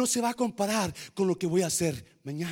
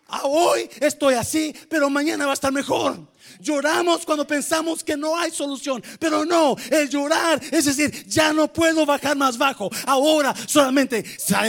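loud, high, rising preacher's cry; fervent revival preaching; preaching at full force; 5 semitones higher, 4 dB louder than usual. Acted. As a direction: lift the whole church with fiery conviction.

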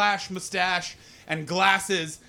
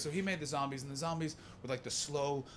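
usual speaking voice

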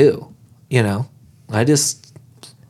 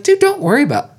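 pitched way up, casual and easygoing; retold high-pitched dude, no worries; high breezy reassurance; 13 semitones higher, faster than usual. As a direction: brush it off lightly, voice jumping high. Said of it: "And I was like".

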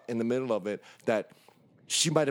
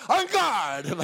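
mock fencer; 7 semitones higher, 3 dB louder than usual.

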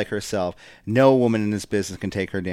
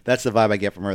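ordinary talk, nothing marked

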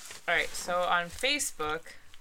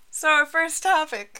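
announcer voice; 10 semitones higher, 10 dB louder than usual. Acted. Announcing the first topic.

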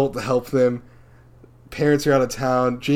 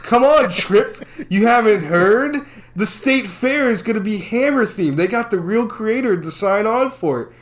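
cameo character; 9 semitones higher, 5 dB louder than usual. Retold or imitated. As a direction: play the random cameo guy big and goofy. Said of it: "Jason Dolly says".

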